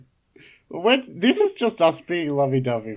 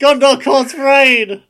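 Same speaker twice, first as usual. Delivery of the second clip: slow, high, loud battle call; epic shout; 10 semitones higher, 11 dB louder, slower than usual. Pretend. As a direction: bellow it to summon the army. Lord of the Rings beacon cry.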